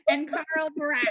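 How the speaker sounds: loud, swallowed delivery of a name; gulped name. Real, not acted. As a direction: lean into it loudly, gulping the word. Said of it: "you gulped in that".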